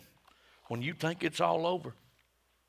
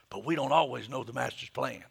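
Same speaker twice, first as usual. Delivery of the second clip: usual speaking voice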